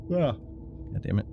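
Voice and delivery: ordinary delivery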